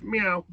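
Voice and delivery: high, drawn-out imitated meow; playful mock cat meow. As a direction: mimic the cat calling from the ivy.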